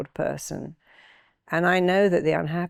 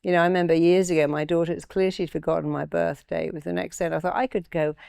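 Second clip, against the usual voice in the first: ordinary talk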